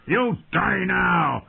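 high, drawn-out battle cry; screamed taunt. A narrator's reading. Warrior taunting the wounded knight mid-fight, screamed. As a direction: shout it slowly as a savage threat. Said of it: "Cotho screamed taunts at him".

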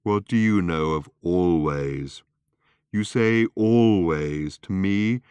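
slow, drawn-out scornful retort; indignant robot. Acted. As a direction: lean heavily and slowly on the words. Marvin indignantly throws back "always.

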